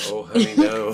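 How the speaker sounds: high, camp "oh honey no"; scolding a fumbling tourist, high and campy; sassy mock scold. Teasing friend cuts in.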